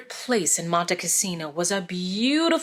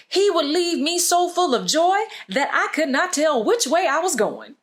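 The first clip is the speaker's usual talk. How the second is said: higher, brighter-timbred voice of a delighted friend; joyful friend; 7 semitones higher than usual. Acted. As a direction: voice the friend lit up with joy. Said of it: "one of his friends remembered".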